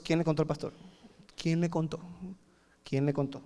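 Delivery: low, hushed questioning to oneself; acted quiet suspicion; suspicious muttering. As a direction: mutter it like a churchgoer who feels found out. Voicing a churchgoer who suspects he's exposed.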